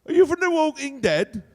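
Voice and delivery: loud, high, excited London accent; Cockney-style accent. Shown as a demonstration. Telling a zombie story to a crowd, loud Cockney-style accent. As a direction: rant in exclaiming Cockney. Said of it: "those kinds of accents".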